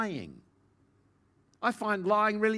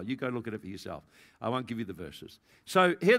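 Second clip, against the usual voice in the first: usual speaking voice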